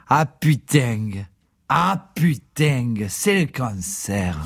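put-on Provençal accent. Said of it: "avec sa bonne trogne méridionale".

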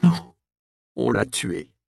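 rising anger. Acted. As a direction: snap back at her mother. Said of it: "la colère monta au front d'Alice". (colère d'adolescente qui éclate)

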